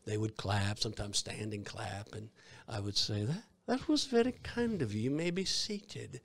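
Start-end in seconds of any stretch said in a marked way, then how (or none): 3.2-6.2 put-on bow graciously in a stage British accent. Scrooge actor thanks the clapping audience in British accent. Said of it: "my southern voice now".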